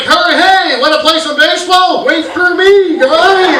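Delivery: high, loud mock calling out; eager hapless buddy. Maimed friend calls after the guys, eager shout. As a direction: shout after the others like the left-behind loser.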